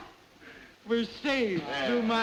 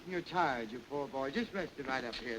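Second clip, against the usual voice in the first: soft, lower mock coddling; mock tender; 6 semitones lower, 5 dB quieter than usual. General coos over a tired soldier, softly. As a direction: soothe the poor boy, hammed-up.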